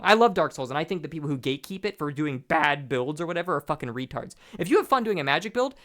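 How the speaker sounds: usual speaking voice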